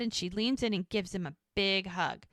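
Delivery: normal talking voice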